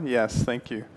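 ordinary delivery

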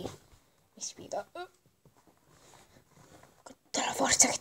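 breathless whisper. Real, not acted. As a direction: whisper it through effort. Whispered straining comment.

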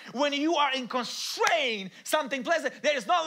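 loud, high, shouted sermon; preacher building to a shout; full-force preaching. Acted. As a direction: preach it out at the top of your voice.

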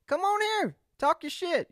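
high, loud hype host voice; hammed-up host calling guests on; podcast host inviting guests, hammed-up hype voice; mock talk-show host.

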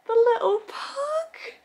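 very high, slow squealing gush; cute-overload squeal. Real, not acted. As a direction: squeal like seeing a puppy. Gushing over cute pug.

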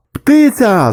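high, excited call-out; sharp high-pitched shout; shouting from afar. A narrator's reading. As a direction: yell it across the deck as a sighting. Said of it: "закричали з баржі".

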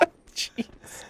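breathless laughing whisper. Real, not acted.

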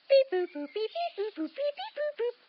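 sung high beeps and boops; high rising beeps and boops; phone dial beeps. Pretend. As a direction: beep out the phone number. Dialing the phone.

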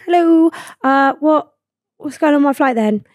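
retold awkwardly polite inquiry; tentative polite query. Passenger asking the desk lady about the flight, tentative.

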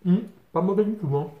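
talking while chewing; chewing mid-sentence; mouth full of burger. Real, not acted. Seen 1: Bitten burger in hand, jaw working, mouth full between bites.

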